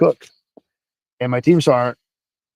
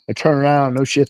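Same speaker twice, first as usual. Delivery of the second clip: ordinary talk, nothing marked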